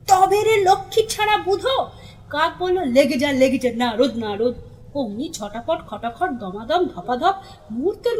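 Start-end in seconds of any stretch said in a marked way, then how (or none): 0.1-1.8 loud, high-pitched battle cry; roaring challenge. A narrator's reading. Udho rolling up sleeves at Budho, roaring challenge. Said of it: "হুঙ্কার দিয়ে উঠল". 2.9-4.5 egging on fight. A narrator's reading. Crow eggs on the fighters.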